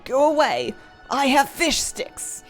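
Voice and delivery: slow, dragged-out ogre voice; lumbering brute, words pulled long; cartoon brute. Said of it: "makes it sound like he's saying".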